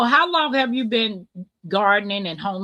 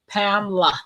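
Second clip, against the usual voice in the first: put-on southern accent; 2 semitones lower than usual. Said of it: "with that southern accent".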